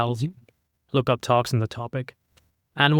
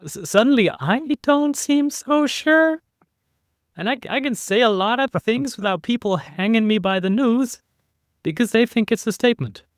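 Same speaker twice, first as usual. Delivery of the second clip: upward inflection; 10 semitones higher than usual. Shown as a demonstration. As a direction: keep the voice lifted high as if never sure. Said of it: "if I use an upward inflection at the end".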